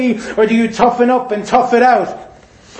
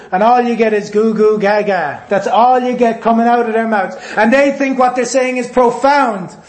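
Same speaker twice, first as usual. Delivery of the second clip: ordinary talk, nothing marked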